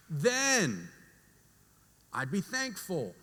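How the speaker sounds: very drawn-out, weighty delivery; slow, pointed wishing. Acted. Preacher voicing people who feel owed, slow and pointed. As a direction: stretch each condition out, pausing before the payoff. Acting out ungrateful wishful thinking.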